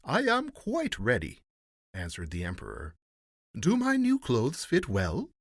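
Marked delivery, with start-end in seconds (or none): 0.0-1.4 high, lilting regal reply; pompous emperor. A narrator's reading. Emperor answering the master of ceremonies, pompous. 3.6-5.3 high, loud, slow question; vain emperor. A narrator's reading. Emperor asking before the looking-glass, vain.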